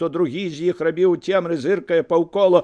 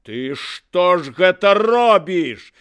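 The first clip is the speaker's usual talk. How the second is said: slow, raised indignant shout; higher, louder, drawn-out protest; shout from crowd; 5 semitones higher, 5 dB louder, slower than usual. A narrator's reading. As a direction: yell it out from the crowd. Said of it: "закрычаў нехта з натоўпу".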